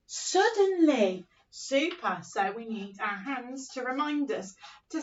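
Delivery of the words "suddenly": slow, higher, drawn out; storyteller savouring a special word; dramatic suddenly. Shown as a demonstration. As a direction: stretch the word for suspense.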